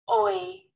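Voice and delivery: "oi" sung out high and clear; diphthong demo. Shown as a demonstration. Teacher modelling the oi diphthong for the class. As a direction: glide "oi" up high for learners. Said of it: "we've been through vowels and diphthongs".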